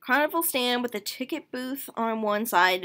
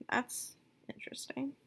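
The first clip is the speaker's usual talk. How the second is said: hushed whispered aside; whispering; 3 semitones higher, 12 dB quieter than usual. Real, not acted. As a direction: whisper it, intrigued.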